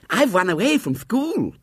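put-on high lisping little girl; small shrill voice. A narrator's reading. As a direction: pipe it up like a small child. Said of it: "shrill voice".

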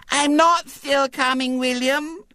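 put-on very high, slow little-girl voice; small triumphant voice. A narrator's reading. Little girl who has caught up with William, small triumphant voice. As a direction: pipe up in triumph as a small child. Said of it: "said a small, triumphant voice".

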